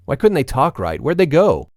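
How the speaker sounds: rising alarm. A narrator's reading. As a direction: let the panic climb. Growing panic in retold questions.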